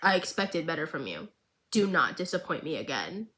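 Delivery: a hint of a cold, disappointed boss; Silco's line. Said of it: "Silco looks at her and goes".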